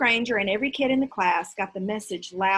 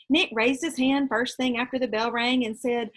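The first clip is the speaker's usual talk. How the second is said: ordinary delivery, nothing marked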